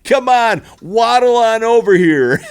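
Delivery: hammed-up imitation of a goofy TV host, cracking up; laughing mock call. Mocking Terry Bradshaw's call.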